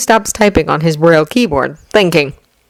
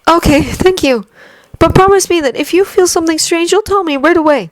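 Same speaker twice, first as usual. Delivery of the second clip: put-on higher, earnest young man's voice; young hero voice; 12 semitones higher than usual. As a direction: voice him tense but polite. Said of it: "Midoriya's smile was a little tense as he said".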